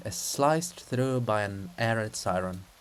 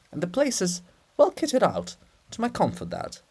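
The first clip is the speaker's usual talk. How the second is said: a hint of a self-important monk; lazy, self-satisfied justification; lightly voiced pompous scribe; 7 semitones higher, 3 dB louder than usual. Cyril defending his rest to the princess, mildly smug.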